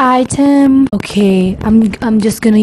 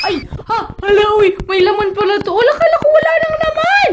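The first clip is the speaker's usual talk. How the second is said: much higher, fast startled exclaim; surprised; 9 semitones higher, faster than usual. Real, not acted. Vlogger finding the product still full, much higher startled voice. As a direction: caught off guard, squealing a little. Surprised the bottle isn't empty.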